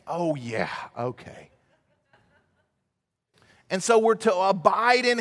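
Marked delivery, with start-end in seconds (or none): 0.1-1.5 low, soft "oh yeah, okay"; sheepish realization. Retold or imitated. Believer reminded by a friend, sheepishly settling down. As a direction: back down quietly as it dawns on you.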